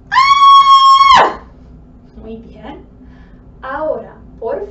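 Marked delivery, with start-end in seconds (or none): 0.1-1.4 long, very high, loud scream; sustained full-volume shriek on "ah". Shown as a demonstration. Teacher acts out the command to scream, piercing high scream.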